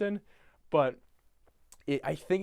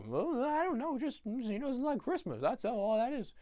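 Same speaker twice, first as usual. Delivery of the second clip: high-pitched, softer imitation of the townsfolk; other characters; 8 semitones higher, 4 dB quieter than usual. Answering why he hates Christmas, high mock voice. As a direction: imitate them in a high, halting little voice. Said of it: "And they're like".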